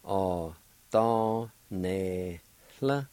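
"O, do, ne, hla" in four separate syllables. Shown as a demonstration. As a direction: sound out "O, do, ne, hla" with a pause after each one. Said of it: "is pronounced like this".

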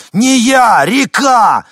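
full-volume, high-pitched correction; desperate yell. Acted. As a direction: scream it, out of patience. Мужчина кричит непонимающей женщине. (отчаянный крик во весь голос)

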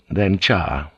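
plain reading voice

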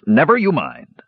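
high, irritated dismissal; irritable snap. A narrator's reading. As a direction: cut the topic off irritably. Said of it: "Dugan snapped irritably".

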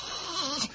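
sharp excited intake of breath; excited gasp. Real, not acted. Pastor hearing they will fly over Toronto, gasping. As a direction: gasp in delight. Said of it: "I couldn't really yell".

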